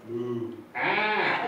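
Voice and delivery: half-moo, half-bleat animal imitation; goat mooing like a cow. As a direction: start as a cow, jump up into a goat scream. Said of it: "the one who's going".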